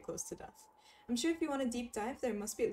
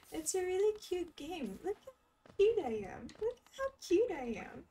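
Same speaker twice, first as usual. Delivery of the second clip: cute high voice; 10 semitones higher, 4 dB louder than usual. Pretend. Voicing cute character.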